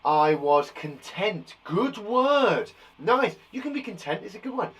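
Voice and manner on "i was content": slow, drawn-out read; slow reading aloud. Shown as a demonstration. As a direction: stretch each word out. Reading child's sentence.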